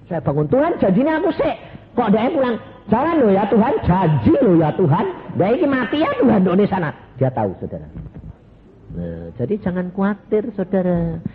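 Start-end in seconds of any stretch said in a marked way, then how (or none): 0.5-6.9 high, loud, fast protest; wife's protest. Retold or imitated. Wife protesting to God about the promise, high and loud. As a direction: plead shrilly that it was promised.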